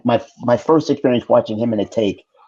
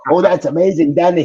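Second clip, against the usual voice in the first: higher and louder, thrilled; delighted; 9 semitones higher, 6 dB louder than usual. Retold or imitated. Arnold praises Danny's impression, higher and louder with delight. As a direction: gush with delight.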